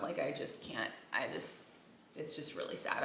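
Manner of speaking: fighting not to cry, barely voiced; holding back tears. Real, not acted. Mother missing the cat she lost, real tears held back. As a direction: voice dropping low and breaking into a near whisper.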